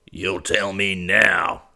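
put-on very slow, hard old rancher order; old man's bark. A narrator's reading. Bryant barks an order at his nephew. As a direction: lay down the law. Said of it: "barked Bryant".

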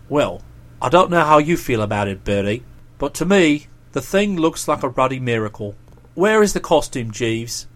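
deep, deliberate dialogue voice; Boko, sceptical then impressed. Acted. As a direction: play a low-voiced chap weighing things up. Boko's lines in narrated dialogue.

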